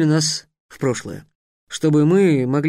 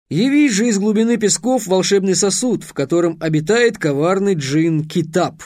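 incantation; 4 semitones higher than usual. A narrator's reading. Trix casts a summoning spell.

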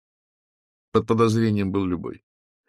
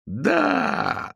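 frenzied crowd cheer. A narrator's reading. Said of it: "толпа неистовствовала".

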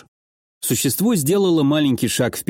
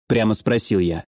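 plain reading voice

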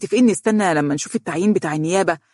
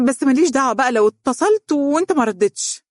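higher, light teasing tone; trying to joke; 9 semitones higher, 3 dB louder than usual. Acted. Donia teasing her serious fiancé, light and playful. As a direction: tease him to ease the tension. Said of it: "حاولت أن تمزح".

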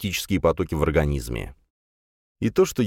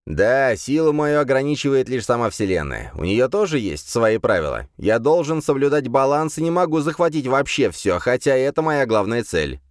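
arrogant demon lord; 6 semitones higher than usual. Acted. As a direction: lecture him like an ancient overlord. Demon explains his limits.

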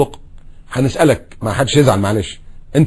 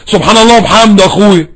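louder, higher one-upping reply; retorting with mock piety; mock defiant retort; 5 semitones higher, 5 dB louder than usual. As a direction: answer back in a challenge. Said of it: "بص له كده".

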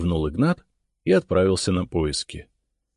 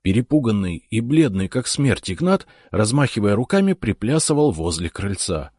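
ordinary delivery, nothing marked

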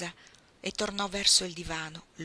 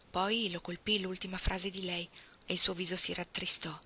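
plain reading voice

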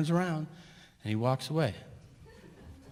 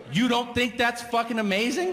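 high, exasperated disbelief; can't believe he doesn't care; incredulous; 8 semitones higher than usual. Retold or imitated. Exasperated at waiter.

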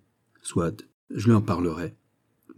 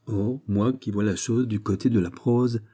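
usual speaking voice

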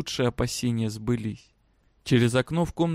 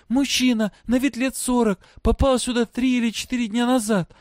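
high-pitched voice projected to a crowd; calling out; 9 semitones higher, 3 dB louder than usual. A narrator's reading. Girl describing her father to prisoners, calling out. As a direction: call out each detail, high and urgent. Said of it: "кричала она".

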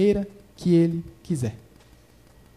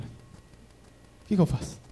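whispered prayer; 7 dB quieter, much slower than usual. Retold or imitated. Silent prayer retold in waiting room.

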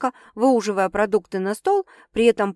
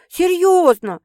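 higher, louder, a touch of insistence; emphatic agreement; 9 semitones higher, 8 dB louder, slower than usual. A narrator's reading. Sister mildly insisting to Sasha that she means it. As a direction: press the point home. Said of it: "убедительно поддакнула".

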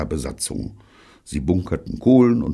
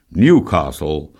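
drawn-out, louder announcement; affable; 6 dB louder, slower than usual. A narrator's reading. Bunny affably announcing the town in sight to the crew, affable. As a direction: declare it affably, taking time over it. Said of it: "erklärte Bunny leutselig".

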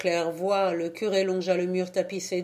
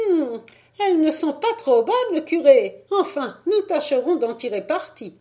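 put-on much higher, louder, doubtful old woman; old lady doubtful; 9 semitones higher, 6 dB louder than usual. A narrator's reading. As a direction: weigh the poor shirts with a sniff. Old lady judges the shirts. (vieille demoiselle sceptique, voix aiguë)

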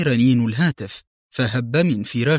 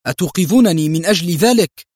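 higher, louder irritated outburst; annoyed exclaim; 4 semitones higher, 5 dB louder than usual. A narrator's reading. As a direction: snap back, woken up and cross. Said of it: "هتف في حنق".

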